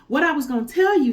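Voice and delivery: high, loud, fast taunt from safety; cocky monkey. A narrator's reading. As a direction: gloat from the treetop. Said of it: "said Monkey".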